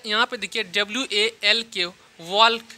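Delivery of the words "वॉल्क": वॉल्क with the L sounded before the K; mispronounced वॉल्क, L not silent; common mispronunciation. Shown as a demonstration. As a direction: say वॉल्क with an audible L. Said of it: "बहुत लोग ऐसे पढ़ते हैं".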